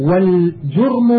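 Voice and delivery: والجرم said "wal-jurm", u after the j; rounded short u in والجرم; damma on الجرم. Shown as a demonstration. Reader explaining the word for sin, والجرم as "wal-jurm". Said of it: "بالفتح والضم".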